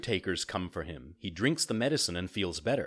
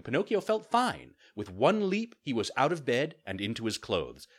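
ordinary delivery

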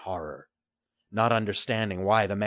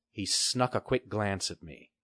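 ordinary delivery

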